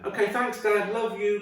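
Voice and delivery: put-on high child's thanks; sweet obedient kid voice; high-pitched trusting son; grateful kid.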